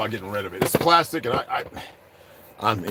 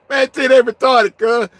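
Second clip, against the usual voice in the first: laughing; 14 semitones higher, 10 dB louder, slower than usual. Real, not acted. Laughing at viewers.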